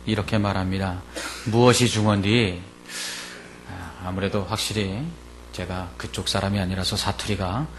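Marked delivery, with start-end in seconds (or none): none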